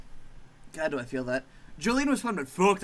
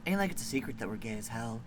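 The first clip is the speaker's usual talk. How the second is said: lower, soft easygoing monster; mellow monster; 5 semitones lower, 3 dB quieter than usual. Acted. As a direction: shrug it off lazily. Lucy the boneyard monster.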